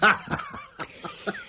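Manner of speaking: a burst of high, delighted laughter; bright, amused laugh at her answer. Acted. Uncle amused by niece's wit.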